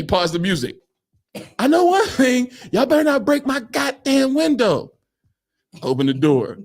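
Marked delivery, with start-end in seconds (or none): 1.6-4.9 high, raised-voice threat in imitation; mock stern warning. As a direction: act out someone laying down the law. Retold warning over the window.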